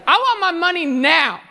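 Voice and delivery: high, insistent whine; bratty demand. Acted. Younger son demanding money from dad, bratty and high.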